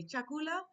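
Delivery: "Chakula" called high with a rise; Swahili word. Shown as a demonstration. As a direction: ask for Chakula, calling out. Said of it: "so I'll say".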